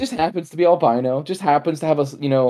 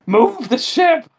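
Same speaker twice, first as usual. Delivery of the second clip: shrill angry outburst, much higher; high mock yell; 13 semitones higher, 3 dB louder, slower than usual. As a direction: bark it like an irritated boss. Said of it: "Vizzini's like".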